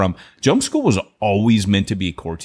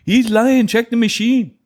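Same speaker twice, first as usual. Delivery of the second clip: hammed-up Canadian accent, high and loud; loud mock Canadian accent; 11 semitones higher, 4 dB louder than usual. Mock Canadian pastor calling his co-host a liar, loudly, in a hammed-up accent. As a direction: bark it out like an outraged Canadian. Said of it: "a Talk Like a Canadian Day".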